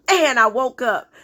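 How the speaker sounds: usual speaking voice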